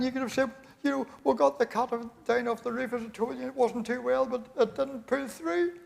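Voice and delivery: high, distressed woman's voice; sick wife scolding her husband, high and upset. Acted. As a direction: wail it like a wife in a hospital bed. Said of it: "She says".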